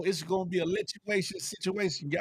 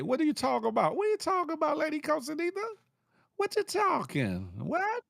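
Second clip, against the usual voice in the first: incredulous; 10 semitones higher than usual. Real, not acted. Mock disbelief at a listener's comment.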